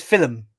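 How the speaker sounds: "Film" with the L pronounced heavily, fil-lum; stressed L. Retold or imitated. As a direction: imitate a friend saying "Film" in two syllables. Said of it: "He pronounces the L".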